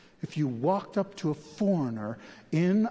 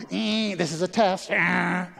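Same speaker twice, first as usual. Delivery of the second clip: light mock of an old TV broadcast test; TV test announcement; 5 semitones higher, 5 dB louder than usual. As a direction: play the end-of-night station test, a bit higher. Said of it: "When I was growing up at the T V".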